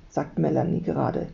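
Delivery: usual speaking voice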